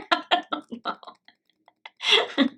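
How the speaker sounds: words breaking up into laughter; real laughter. Real, not acted. Reactor asked if the accents sound similar, really laughing. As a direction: crack up on a high, stretched-out admission.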